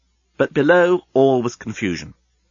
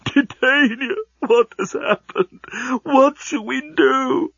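slow, high-pitched weeping wail; hammed-up tearful lament; sobbing; 13 semitones higher, slower than usual. A narrator's reading. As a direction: wail it out, drawn-out and despairing. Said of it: "he was sobbing".

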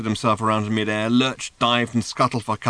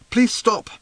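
higher, louder plea; pleading Arthur; 11 semitones higher, 6 dB louder than usual. A narrator's reading. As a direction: beg him to stop, higher and louder. Arthur overwhelmed by the man.

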